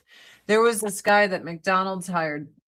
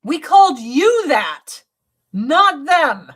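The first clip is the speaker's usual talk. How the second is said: indignant retort; 5 semitones higher, 5 dB louder than usual. Retold or imitated. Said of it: "I'm like".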